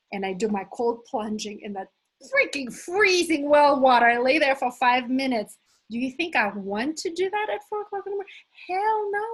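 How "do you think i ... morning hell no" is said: fast, high rising rhetorical question; mock incredulous. Coach joking about four a.m. cold plunges, high and fast. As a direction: sell the absurdity, then a high hell no.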